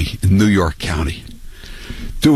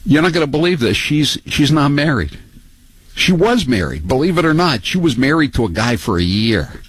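incredulous gossip; 7 semitones higher than usual. Real, not acted. Host gossiping in disbelief.

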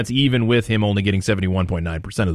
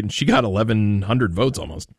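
ordinary delivery

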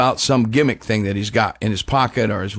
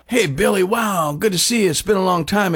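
higher, eager fan hello; starstruck greeting; 7 semitones higher than usual. Retold or imitated. Fan greeting [name] excitedly.

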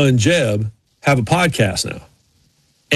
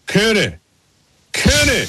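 drawn-out mock call, rising higher on the repeat; imitated calling out; 6 semitones higher, slower than usual. Driver interrupting a live interview, imitated high call. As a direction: call the name as if interrupting from a distance.

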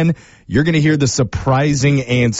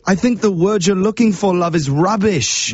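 put-on British accent; 6 semitones higher than usual. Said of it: "The accent's a bloody war crime".

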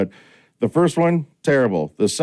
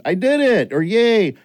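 cheer; 8 semitones higher, slower than usual. Retold or imitated. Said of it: "she yells".

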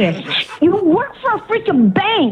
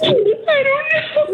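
tearful; 11 semitones higher, much slower than usual. Real, not acted. Caller still tearful.

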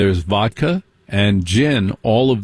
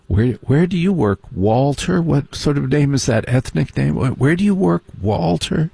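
snobbish preppy; 5 semitones higher than usual. Retold or imitated. Snobbish preppy quizzing him.

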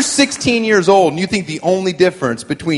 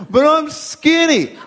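mock whiny teen; 8 semitones higher, slower than usual. Mocking a vain teen girl.

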